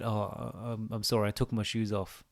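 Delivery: sheepish apology. Retold or imitated. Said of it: "she said".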